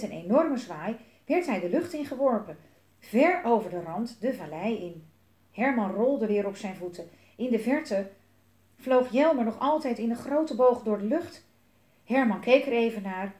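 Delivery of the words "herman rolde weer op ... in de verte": usual speaking voice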